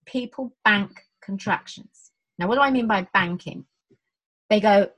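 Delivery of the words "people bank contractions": slow, deliberate, every word spelled out; slow and clear. Shown as a demonstration. Slowing right down so listeners catch the key phrase. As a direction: lay each word down slowly for the listener. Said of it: "can I say this slowly and clearly?".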